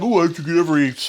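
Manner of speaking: lightly voiced fussy perfectionist; mock fussy cook. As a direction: briefly parody an over-careful cook. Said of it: "So I'm not like".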